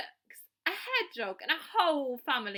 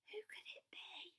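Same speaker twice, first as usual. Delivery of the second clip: whispering; 6 semitones higher, 17 dB quieter than usual. Acted. Hidden animals wondering, gasp.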